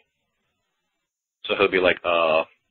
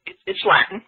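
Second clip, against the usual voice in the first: insistent; 9 semitones higher than usual. Real, not acted. Insists over the other speaker.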